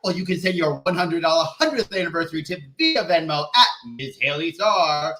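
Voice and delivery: usual speaking voice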